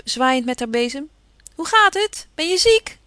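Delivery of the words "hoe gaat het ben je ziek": Jo calls up to the window, high and loud; calling up. Acted. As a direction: shout it cheerfully up to a boy at an upstairs window. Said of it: "lachte terug en riep". (Jo roept vrolijk luid naar boven)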